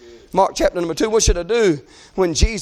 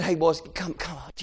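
low, soft mock discouragement; hushed crowd voice; 5 semitones lower, 10 dB quieter than usual. Crowd telling the crying men to calm down, hushed. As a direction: quietly shush them, much lower than preaching.

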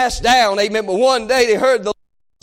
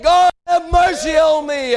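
high, drawn-out plea for mercy; lifted-up cry; 6 semitones higher, slower than usual. Acted. Preacher voicing blind men calling on Jesus, crying out. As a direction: cry it out slowly to heaven. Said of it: "They lifted up their voice".

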